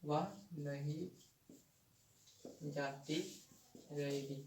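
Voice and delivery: spaced out, one word at a time, like dictating to a class; slow dictation. Shown as a demonstration. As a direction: read each word out slowly so the class can copy it down. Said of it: "जैसे कि".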